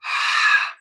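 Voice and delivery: voiceless open-throat huff; open H breath. Shown as a demonstration. Teacher demonstrating breath on cold hands. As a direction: let air out with an open throat. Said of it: "they go".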